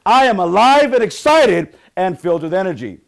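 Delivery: very loud, high-pitched group chant; rousing affirmation. Shown as a demonstration. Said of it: "Let's try it one more time".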